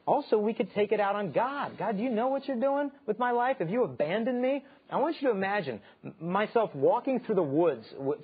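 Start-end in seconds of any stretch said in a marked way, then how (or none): 1.8-4.6 higher, pleading complaint aimed at God; complaining to God. Acted. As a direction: lift the pitch like a hurt believer.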